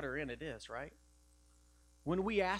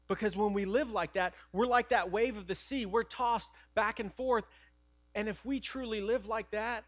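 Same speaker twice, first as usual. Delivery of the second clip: fiery preaching; 6 semitones higher, 6 dB louder than usual. Acted. Loud preaching again.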